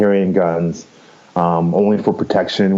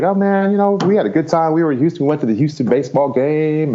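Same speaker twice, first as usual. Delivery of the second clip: higher-pitched mock chummy chatter; imitating breezy small talk; 8 semitones higher than usual. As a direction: make it sound like it's all good. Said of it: "make it seem like it's all good".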